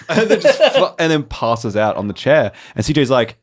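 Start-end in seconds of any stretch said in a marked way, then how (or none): none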